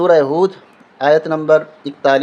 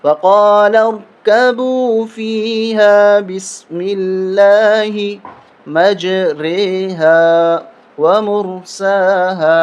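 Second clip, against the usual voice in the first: slow, higher melodic tajweed chant; Quranic recitation; 4 semitones higher, 3 dB louder, much slower than usual. Shown as a demonstration. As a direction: chant the verse with long drawn vowels. Recites the verse.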